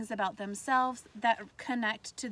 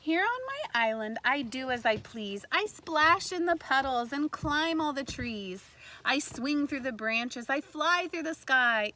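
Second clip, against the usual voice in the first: louder, playful read-aloud; lively story reading; 2 semitones higher, 4 dB louder than usual. A narrator's reading. Teacher reading to kindergartners, playful lively read-aloud. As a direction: bounce through it like a playful kid. Reads picture book to kindergartners.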